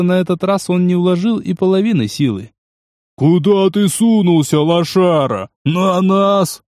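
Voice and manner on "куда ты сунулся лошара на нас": higher, pumped-up thug taunt; aggressive taunting. Acted. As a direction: work yourself up as you taunt. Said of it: "подстёгивал себя жирный".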